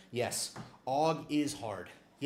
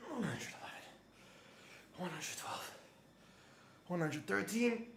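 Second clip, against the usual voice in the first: shaking with effort; 8 semitones higher, 11 dB quieter than usual. Real, not acted. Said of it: "My legs are shaking".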